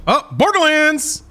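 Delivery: high, drawn-out excited call; excited recognition. Real, not acted. Viewer spotting the first world premiere, excited.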